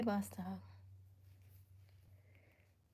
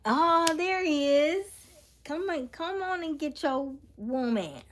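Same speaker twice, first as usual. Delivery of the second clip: excited cheer; 9 semitones higher, 7 dB louder than usual. Real, not acted. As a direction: cheer his arrival, stretching the first words. Excitedly cheers an arrival in chat.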